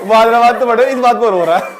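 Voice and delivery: loud, laughing punchline; amused disbelief. Real, not acted. Teacher tells class what the boy cried over, loudly laughing. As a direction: tell it loudly through real laughter. Cracks up telling the story.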